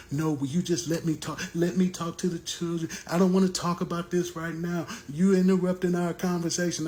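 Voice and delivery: a mock heated mother snapping back; mother's angry pushback. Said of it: "she just fighting".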